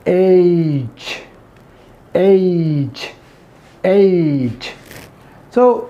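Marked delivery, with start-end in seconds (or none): 0.1-4.7 "एच" stretched with a glide, "eyyy-ch"; gliding H. Shown as a demonstration. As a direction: slide slowly from A into ch in "एच". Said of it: "अब इसको से glide करेंगे". ("एच" को glide करके)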